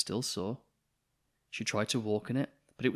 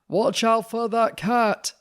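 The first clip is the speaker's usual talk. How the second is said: high, loud, slow warning cry; loud, high alarmed shout; 12 semitones higher, 9 dB louder, slower than usual. Acted. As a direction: yell a warning across the room. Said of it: "shouted the vet".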